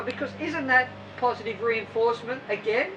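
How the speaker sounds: ordinary talk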